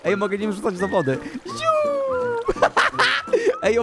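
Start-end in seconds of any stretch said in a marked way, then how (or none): 1.5-2.5 high playful flying sound; whoosh noise. Pretend. Imitates throwing the villager. 2.6-3.6 high amused laughter. Real, not acted. Amused at thrown villager.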